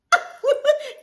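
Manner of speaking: high loud burst of laughter; stunned cackle; shocked laugh. Real, not acted. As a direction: laugh out in disbelief. Shocked at gift.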